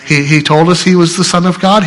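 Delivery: normal talking voice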